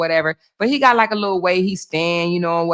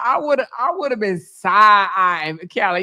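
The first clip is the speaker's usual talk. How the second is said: normal talking voice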